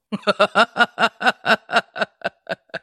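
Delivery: high falling giggle; real laugh; amused laugh. Real, not acted. Laughing after the game show point.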